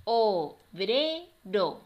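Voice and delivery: slow, loud "O-bre-ro" in three beats; teacher counting out each syllable of "Obrero". Shown as a demonstration. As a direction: split "Obrero" with clear gaps. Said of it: "vamos a separar cada una".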